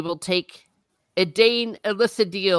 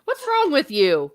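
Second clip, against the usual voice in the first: mock outrage; 10 semitones higher, 4 dB louder than usual. Real, not acted.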